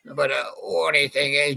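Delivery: ordinary talk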